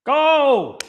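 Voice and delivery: sudden high yell at the goal; shouting. Real, not acted. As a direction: roar it out at the screen. Shouting at the football match.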